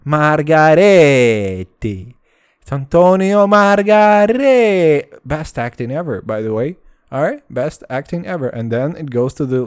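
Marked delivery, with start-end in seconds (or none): 0.0-5.2 loud, slow, higher exaggerated Italian; hammy Italian accent. Shown as a demonstration. Correcting his sister, loud slow hammy Italian accent. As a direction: drag the name out like a proud fake Italian. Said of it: "but it's".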